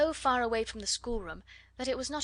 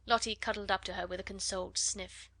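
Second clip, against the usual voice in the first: ordinary delivery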